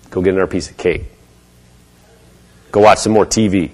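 low, mopey, muttered self-pity. Pretend. Preacher mimics a self-pitying inner voice, mopey and low. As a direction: sulk it out low and defeated.

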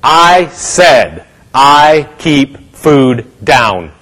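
very slow, word-by-word insistence; emphatic declaration. Retold or imitated. Minister coaching sick woman what to say, slow firm declaration. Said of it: "you say".

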